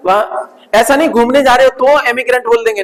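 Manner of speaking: ordinary talk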